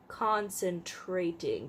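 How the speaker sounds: "Concentrating" said slowly, syllable by syllable; slow careful pronunciation. Shown as a demonstration. Reading back a misspelled word, slow and careful. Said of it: "good attempt at the word concentrating".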